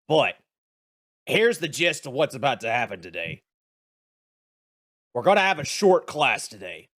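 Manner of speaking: a teacher who wants to go drinking; crude grumpy teacher. Acted.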